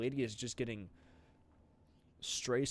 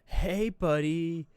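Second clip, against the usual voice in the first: loud, slow, stretched greeting; cheery call; 5 semitones higher, 11 dB louder, much slower than usual. Acted. Medic greeting a patient loudly before treatment.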